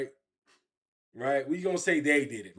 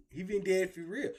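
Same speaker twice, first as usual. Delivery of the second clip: ordinary talk, nothing marked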